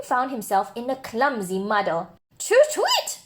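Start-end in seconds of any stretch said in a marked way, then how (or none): 2.4-3.2 very high, cross little toot; cross owl hoot. Acted. Owl crossly hooting at clumsy Douglas. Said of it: "said the owl crossly".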